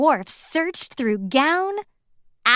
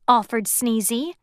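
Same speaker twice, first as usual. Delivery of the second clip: usual speaking voice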